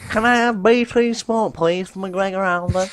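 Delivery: high, slow mocking caller voice; mock customer on the phone; mimicking callers. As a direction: whine it like an endless caller. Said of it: "all day, every single call".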